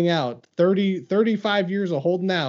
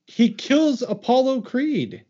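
mock outrage; 8 semitones higher, slower than usual. Arguing a mock dispute.

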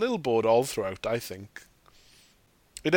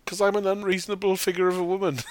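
fast, higher mock shout; mock shouted wife line; 7 semitones higher, faster than usual. Answering as the wife, fast high mock shout. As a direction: blurt it like a self-mocking wife. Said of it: "answering as the wife".

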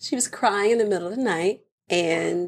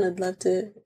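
ordinary talk, nothing marked

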